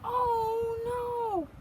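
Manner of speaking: high, cried-out oh no; dismayed oh no. Acted. Reader acts out the popped button, dismayed cry. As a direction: wail it like the button just flew off.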